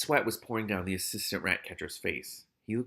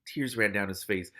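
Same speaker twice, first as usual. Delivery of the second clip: plain reading voice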